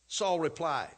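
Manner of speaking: ordinary delivery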